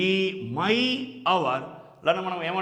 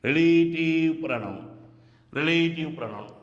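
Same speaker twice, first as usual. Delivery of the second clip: teacher spelling out relative pronoun by ear. Shown as a demonstration. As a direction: draw out each long vowel in relative pronoun. Teacher repeats term drawn out.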